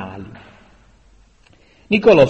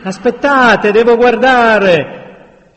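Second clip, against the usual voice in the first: loud, raised shout, slowing at the end; booming command; 10 semitones higher, 7 dB louder, slower than usual. A narrator's reading. Leader pushes through the men, booming command. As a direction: call out over the crowd. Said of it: "rimbombò una voce profonda".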